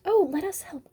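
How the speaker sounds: high, pleading little owl voice; begging child. A narrator's reading. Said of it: "begged the biggest baby".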